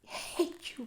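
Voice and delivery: high, hurt outburst at the top of her voice; lashing out at Missus Lynde in a high angry cry; cried out in anger. Acted. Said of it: "cried Anne".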